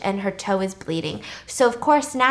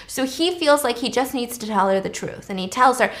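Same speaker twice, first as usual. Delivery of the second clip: usual speaking voice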